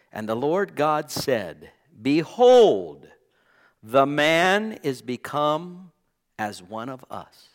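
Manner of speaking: slow, loud solemn reading of God's words; scripture reading. Shown as a demonstration. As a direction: read it out like the voice of God, with weight. Reading scripture aloud in sermon.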